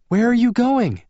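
high female call. A narrator's reading. Bella calling out.

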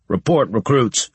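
demanding sergeant bark; gruff barked parade orders. A narrator's reading. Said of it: "Harris demanded".

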